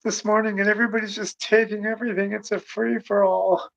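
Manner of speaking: high, breathless imitation of a woman rushing out with news; excited woman. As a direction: mimic her hurried, excited gush. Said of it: "She's like".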